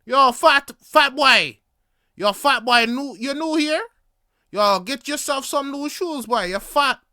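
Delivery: loud, high mocking "yo fat boy" in a Jamaican accent; Jamaican heckle. Locals loudly heckling the newcomer, mocking Jamaican accent. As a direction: holler it across the street like a Jamaican heckler.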